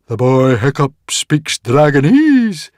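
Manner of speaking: loud, high, drawn-out astonishment; surprised exclamation. A narrator's reading. Druid Guardian learns of the boy's gift, exclaiming in surprise. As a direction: stretch it out in loud amazement. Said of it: "exclaimed the Druid Guardian in surprise".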